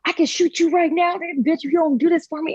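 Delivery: threatening man. Retold or imitated. Gunman threatening her at the gas station, menacing. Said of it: "was just like".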